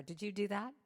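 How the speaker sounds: ordinary delivery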